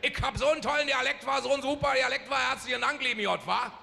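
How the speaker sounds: hohes, lachendes Berlinerisch. Retold or imitated. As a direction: Berliner Schnauze nachmachen. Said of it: "Der Berliner, der sagte". (Berlinerisch, deutlich höher, mit Lachen)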